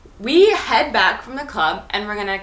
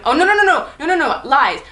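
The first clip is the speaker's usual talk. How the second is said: catching her own mistake; 4 semitones higher, 4 dB louder, faster than usual. Real, not acted. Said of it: "I lied".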